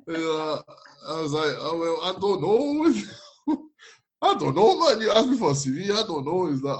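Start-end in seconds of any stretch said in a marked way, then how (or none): 1.6-6.5 high-pitched helpless comeback; flustered protest. Retold or imitated. Answering the friend who asked for his CV, flustered. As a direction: plead ignorance, voice riding up high. Acted argument over the CV.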